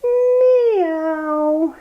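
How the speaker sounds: high, rising playful meow; a teacher meowing for the kids in cat pose. Pretend. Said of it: "come up and say".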